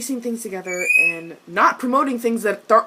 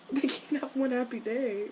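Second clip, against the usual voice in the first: normal talking voice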